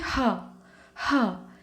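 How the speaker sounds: हा said with an open breathy h; teacher showing the visarga sound; model sound. Shown as a demonstration. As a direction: demonstrate the हा sound clearly. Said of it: "इसका उच्चारण कैसे करते हैं?".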